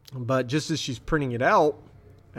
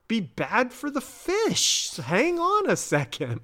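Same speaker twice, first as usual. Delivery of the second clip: much higher, drawn out on "fish"; high-pitched protest; 10 semitones higher than usual. Retold or imitated. Scully holding off the impatient agent, high-pitched protest. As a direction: stall with an exaggerated high objection.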